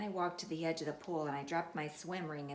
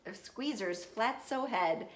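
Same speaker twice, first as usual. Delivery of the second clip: plain reading voice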